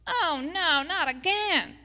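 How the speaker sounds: high, loud mock groan of defeat; hammed-up cartoon villain wailing oh no; defeated Satan. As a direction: play a beaten devil whining in dismay. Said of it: "You can picture him like".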